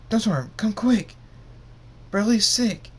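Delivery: high, loud panicked call; urgent alarmed shout. Acted. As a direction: burst in yelling for help. Dave bursts in, urgent alarm.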